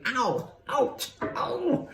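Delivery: high, climbing "ow, ouch, ow"; yelps of pain. A narrator's reading. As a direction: yelp while hopping from the sting. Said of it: "and yelling".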